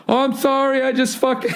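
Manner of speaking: high, flustered mock apology; a hammed-up campy stammer of an apology. Guy who smacked him, backing off in a hacky high voice.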